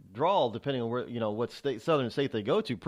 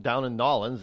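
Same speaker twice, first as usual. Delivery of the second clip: ordinary talk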